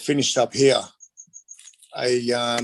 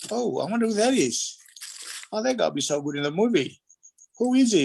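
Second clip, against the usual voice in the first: imitating curious producer; 9 semitones higher than usual. Bond producer voiced wondering aloud.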